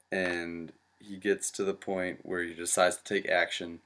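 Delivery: usual speaking voice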